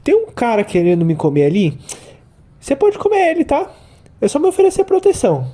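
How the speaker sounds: squeaky, high-pitched fish voice. Acted. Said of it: "É tipo assim".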